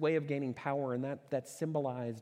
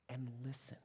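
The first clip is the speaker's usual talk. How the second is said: whisper; 10 dB quieter than usual. Acted. Pastor whispering to the congregation to listen. Asking God to slow down.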